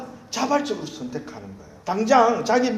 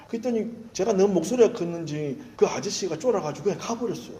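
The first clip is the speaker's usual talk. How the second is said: ordinary delivery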